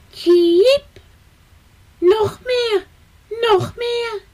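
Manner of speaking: high chirping chick voice; hungry baby bird. A narrator's reading. Baby sparrow begging his parents for food, high chirps.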